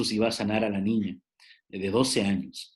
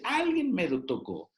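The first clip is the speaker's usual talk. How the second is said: higher, slow wondering question as Jesus; Jesus asking; 10 semitones higher than usual. Retold or imitated. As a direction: let Jesus ask it slowly, puzzled. Jesus quoted asking who touched.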